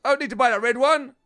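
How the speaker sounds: ordinary delivery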